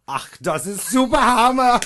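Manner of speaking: high, loud mock German outburst; hammed-up German exclamation. Shown as a demonstration. Comic showing how to fake German, loud exaggerated German accent. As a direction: belt it out like an overexcited German. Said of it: "or you can go".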